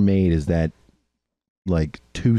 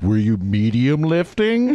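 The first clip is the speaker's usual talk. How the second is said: slow question climbing steeply higher; mock questioning; 4 semitones higher, slower than usual. Fed-up user imitates his fitness tracker's question, mock questioning. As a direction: imitate a clueless app, pitch rising to the end.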